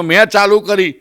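ordinary talk, nothing marked

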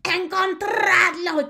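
high, loud command; Grand High Witch ordering a hunt; shriek. A narrator's reading. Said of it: "chilló la gran bruja".

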